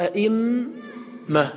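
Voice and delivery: أئمة held long and slow; careful recitation of أئمة; recited. Shown as a demonstration. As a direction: stretch أئمة with a long vowel, high pitch. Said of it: "فقالوا".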